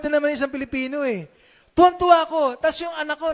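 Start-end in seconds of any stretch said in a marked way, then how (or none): 1.7-3.3 thrilled and loud, pitch jumping up; excited. Real, not acted. Pastor telling the church about the boxing win, overjoyed. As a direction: burst out with joy at the victory.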